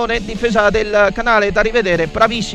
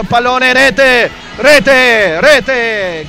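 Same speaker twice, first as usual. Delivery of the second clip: goal shout; 2 semitones higher, 5 dB louder, much slower than usual. Real, not acted. Goal call in live commentary.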